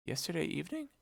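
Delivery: high, hushed incredulous echo; surprised question. A narrator's reading. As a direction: repeat it softly, rising in disbelief. Echoes the news as a question.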